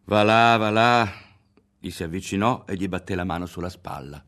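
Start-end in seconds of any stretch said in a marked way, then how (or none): 0.0-1.1 very loud, hearty brush-off; loud, jovial scoffing; amused dismissal. A narrator's reading. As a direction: wave him off in a big booming voice.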